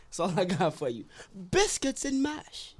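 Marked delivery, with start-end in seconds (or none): 1.5-2.8 slow, laughing mock British order; jokey British food order. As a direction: draw it out in a hammed-up British accent. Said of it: "He practiced that line his whole life".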